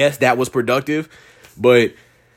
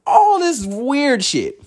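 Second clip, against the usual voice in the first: mock-weird; 12 semitones higher, much slower than usual. Real, not acted. Mocking the rioters' weirdness.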